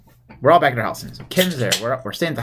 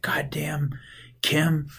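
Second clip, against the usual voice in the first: whispered curse; 4 semitones higher, 6 dB quieter, much slower than usual. Retold or imitated. [name] retelling his reaction.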